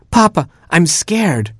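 put-on high, frightened child-animal voice; scared Hamster. A narrator's reading. Hamster to Papa in the cave, scared. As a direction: plead to Papa in a small high voice. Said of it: "murmured Hamster".

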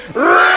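loud mock roar at the teacher; loud growl. Class growling at their teacher, loud mock roar. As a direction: growl all together.

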